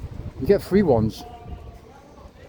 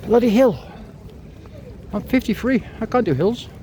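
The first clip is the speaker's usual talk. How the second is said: winded exclamation; 11 semitones higher than usual. Real, not acted. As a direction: gasp it out, beaten by the climb. Puffing up a steep hill.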